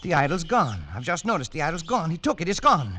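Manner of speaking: high, loud panic as it sinks in; shocked cry that the idol has been taken; alarmed outburst. Acted. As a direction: blurt it out in rising alarm. Discovers the idol is stolen.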